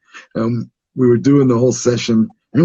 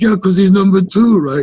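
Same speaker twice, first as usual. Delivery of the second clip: raised-pitch heckling jab; retold taunt; 7 semitones higher, 4 dB louder than usual. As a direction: mimic a friend piling on from the crowd. Friend calls out a taunt.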